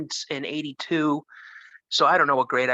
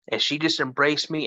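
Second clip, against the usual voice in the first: ordinary talk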